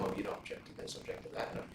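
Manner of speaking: hushed aside. Retold or imitated. Quoting advice whispered at trial.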